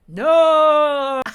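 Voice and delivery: a big shouted no; screamed no. Retold or imitated. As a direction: yell it in all caps. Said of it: "People scream "no" in all caps".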